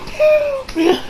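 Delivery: very high, soft imitated meowing; cat meow. Cat meows at the window, high and soft.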